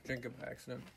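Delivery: eating while talking; crunchy snack sounds; crunching chips. Real, not acted. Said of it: "Sorry for the crunching".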